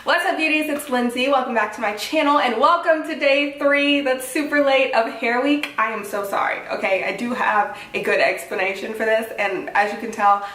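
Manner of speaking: usual speaking voice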